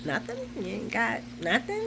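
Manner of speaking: small, soft questioning voice; softly fish for a response, rising at the end; imitating a timid, expectant question; mock disappointment. Voicing his unspoken wait for praise.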